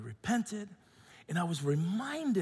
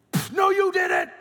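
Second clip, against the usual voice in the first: put-on loud, high Filipino-accented outburst; wife's Filipino side; 11 semitones higher, 6 dB louder than usual. Wife's Filipino temper erupts at husband, loud accented scolding. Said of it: "the Filipino comes out".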